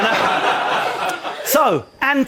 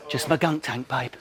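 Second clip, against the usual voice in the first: dropped, softer, smug voice; low sleazy reply; 9 semitones lower, 8 dB quieter than usual. Acted. Pat answering the girls, a low smug mutter.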